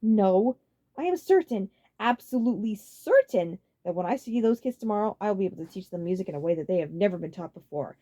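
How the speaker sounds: smug insistence; cocky certainty. A narrator's reading. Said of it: "Roy smirked".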